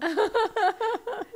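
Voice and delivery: high bright burst of laughter; amused mother laughing. Real, not acted. Laugh after story.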